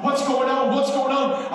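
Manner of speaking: high, loud, startled wife asking what's going on; jolted awake and panicking; alarmed wife. Retold or imitated. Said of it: "she's like".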